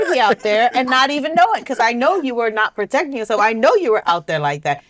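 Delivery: high-pitched, half-laughing accusation; mock accusation. Wife accuses husband after a dream.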